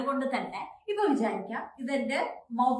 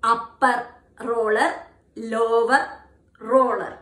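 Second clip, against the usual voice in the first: choppy word-by-word; 7 dB louder, much slower than usual. Shown as a demonstration. Teacher showing the wrong choppy way to say it. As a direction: say it in broken separate words. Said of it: "Never say the tongue twister in this way".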